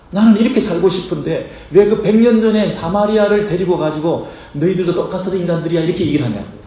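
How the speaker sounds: high, loud indignant outburst; indignant complaint. Pretend. Preacher voicing offended listeners' high, loud indignation.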